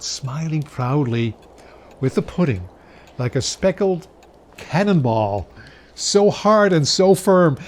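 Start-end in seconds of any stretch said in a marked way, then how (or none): 5.9-7.6 proud emphasis. A narrator's reading. Proud pudding description.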